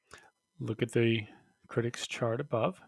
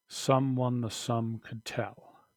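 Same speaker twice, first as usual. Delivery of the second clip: "Someone the sum could tell." said slowly, each word weighed; slow, pointed reading; 3 dB louder, slower than usual. Shown as a demonstration. Teacher quoting the line to point out ambiguity, slowly. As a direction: linger on "sum" so the homonym is heard. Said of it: "Look at that line".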